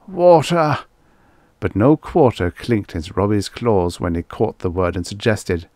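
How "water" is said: high, loud cry for water. A narrator's reading. Injured bystander begs for a drink, crying out. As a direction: call out in need. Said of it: "One cried".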